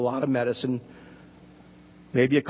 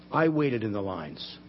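low, slow and softer, mildly tearful quote; retold tearful confession; 7 semitones lower, 5 dB quieter than usual. Preacher quoting crying Martha about waiting, retold tearful confession. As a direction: quote her confession slowly, low and softly. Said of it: "Martha's crying, and she says".

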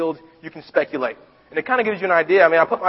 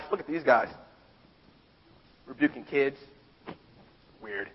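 mocking; 4 semitones lower, 9 dB quieter than usual. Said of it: "poking fun at them".

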